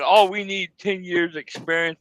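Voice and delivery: ordinary delivery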